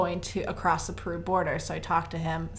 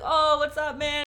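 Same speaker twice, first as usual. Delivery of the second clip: higher, louder buddy greeting; delighted greeting; 7 semitones higher, 5 dB louder than usual. Retold or imitated. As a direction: greet an old pal with raised, put-on enthusiasm. Guy greets drunk friend on bus.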